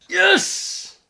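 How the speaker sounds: high, loud yes; delighted shout. Real, not acted. Player cheering his Draco Meteor hit. Good move lands.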